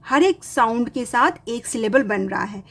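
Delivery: ordinary delivery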